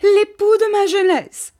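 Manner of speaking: high, loud hysterical wail; crying out. A narrator's reading. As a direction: protest frantically at the top of the voice. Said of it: "s'écria mistress Micawber en se débattant".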